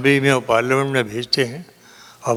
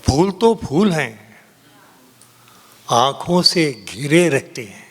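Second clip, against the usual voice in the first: ringing, declaimed Urdu ghazal; poetry recitation; 3 semitones higher, slower than usual. Acted. Poet reciting a ghazal to a cheering audience, declaimed. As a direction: declaim the verse in sweeping phrases, pausing for effect. Declaims couplets, repeats lines.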